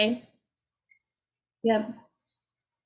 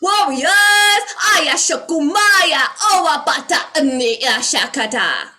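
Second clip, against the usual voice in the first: high, loud, far-off chant of strange words; channeled warrior call; 6 semitones higher, 9 dB louder, slower than usual. Pretend. Rousing sleeping light warriors in a high commanding chant. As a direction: call out like an ancient awakened being. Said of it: "I wanna help them get out and be like".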